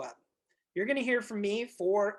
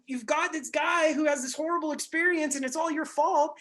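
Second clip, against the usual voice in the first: normal talking voice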